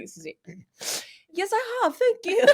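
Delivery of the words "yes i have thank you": very high, loud mock British lady; hammed-up British accent. Host voices the actress's reply, hammed-up British accent. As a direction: sound like an old-time British housewife, high and overdone. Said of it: "it's like Missus Weasley in the fourteenth century".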